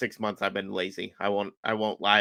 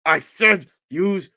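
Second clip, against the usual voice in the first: slow, louder, higher command; retold exasperated order; 6 semitones higher, 5 dB louder, much slower than usual. Coach walks over to the confused guy, exasperated order. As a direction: hammer each word, imitating a fed-up coach.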